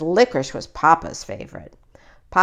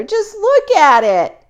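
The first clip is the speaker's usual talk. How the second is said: higher, louder, slow distressed cry; 11 semitones higher, 6 dB louder than usual. A narrator's reading. Brother crying out to Mama about his ruined bike. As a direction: plead over the broken bike. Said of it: "cried Brother".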